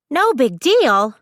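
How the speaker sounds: high, loud and slow disbelief; loud scoffing echo of his words; incredulous exclaim. A narrator's reading. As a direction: throw it back at him, incredulous. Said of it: "exclaims a woman".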